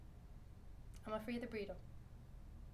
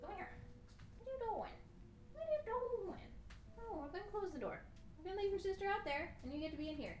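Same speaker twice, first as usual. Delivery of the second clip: pet-directed scolding; 4 semitones higher, 9 dB quieter, faster than usual. Real, not acted. Owner asking the cat why it's mean to its sister, cooing scolding. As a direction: chide a pet in high, cooing tones.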